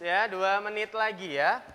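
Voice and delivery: loud, slowed announcement to the whole room; teacher calling out the time limit in Indonesian; calling out to class. Real, not acted. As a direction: make sure the back row hears the time. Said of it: "calling out time to the class".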